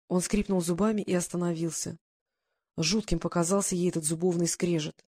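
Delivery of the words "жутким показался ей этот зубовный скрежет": ordinary delivery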